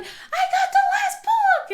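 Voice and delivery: put-on very high, loud, drawn-out kid yell; gleeful squeal with laughter in it; excited kid. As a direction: imitate an overjoyed little boy shouting. Imitates excited son grabbing book.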